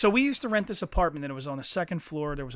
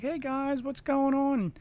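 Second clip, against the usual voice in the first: friendly greeting; 9 semitones higher, slower than usual. Retold or imitated. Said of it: "she's like".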